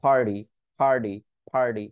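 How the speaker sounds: ডার্টি said "ডার্ডি". Shown as a demonstration. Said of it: "ডি বলে দেয়". (নিচু স্বরে ডার্ডি)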